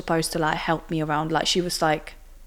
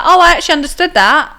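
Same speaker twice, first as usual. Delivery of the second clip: higher and louder, pointed retort; triumphant comeback; 10 semitones higher, 11 dB louder than usual. Retold or imitated. Answering the passport officer back, higher and louder. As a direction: throw it back at him, caught out.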